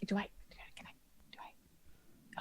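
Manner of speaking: barely audible, voiceless whisper; whispered asking. Acted. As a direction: whisper uncertainly for guidance. Secretly asking companion what to do.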